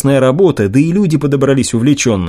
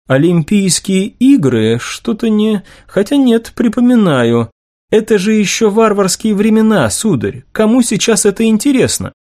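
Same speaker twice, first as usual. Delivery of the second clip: high-voiced lady general, doubting; a distinctly higher woman's voice, dismissive; sceptical lady general; 8 semitones higher than usual. A narrator's reading. Lady general questioning a young man's idea, sceptical.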